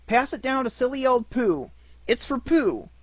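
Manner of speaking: high, loud and eager; bubbling with excitement; excited. A narrator's reading. Christopher Robin passing the present to Pooh, high and eager.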